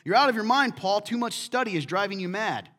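Festus bursting out loud. A narrator's reading. Festus shouts at Paul across the court. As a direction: shout it out in disbelief. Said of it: "in a loud voice".

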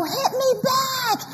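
put-on high, slow childish taunt; taunting little sister. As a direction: goad like a feisty kid. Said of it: "punch and punch and go".

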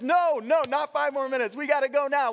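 loud, high, urgent refusal; frantic parent. Retold or imitated. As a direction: overrule the kids in a panic.

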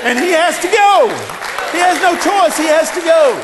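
shouting sermon climax; full-force preaching. Acted. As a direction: build to a roaring climax.

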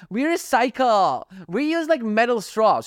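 high, drawn-out mock boasting. Mocking people with recycling stickers on their cars, high mocking voice. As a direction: parody a proud recycler in a high voice.

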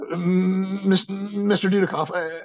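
lightly voiced flustered fan meeting his idol; starstruck fan. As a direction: gush nervously at your hero. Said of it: "I'd be like".

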